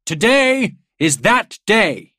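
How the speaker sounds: loud, high and drawn out; ringing declaration. A narrator's reading. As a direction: declare it slowly to a crowd. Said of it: "he declared".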